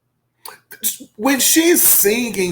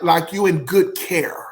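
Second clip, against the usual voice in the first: ordinary delivery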